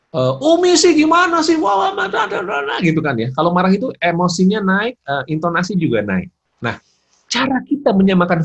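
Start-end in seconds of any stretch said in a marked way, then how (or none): 0.1-2.7 high, loud, gibbering angry rant; angry outburst. Shown as a demonstration. Angry child snapping at mother, shrill loud outburst. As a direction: erupt in a mock tantrum, far higher and louder. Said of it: "kalau marah gimana sih?".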